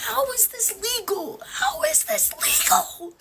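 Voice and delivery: crying with anger over the printer; crying while ranting. Real, not acted. A woman cries to her followers about HP ink, tearful and slow. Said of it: "I may be crying right now".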